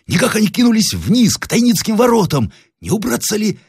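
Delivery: high, urgent workman's cry. A narrator's reading. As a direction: blurt the warning in a high voice. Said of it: "вскричал мастеровой".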